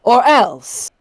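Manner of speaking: menacing aristocratic vampire lady; Lady Dimitrescu voice. Pretend. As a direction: threaten them as a towering vampire countess. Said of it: "putting on your Lady D voice and threatening chat".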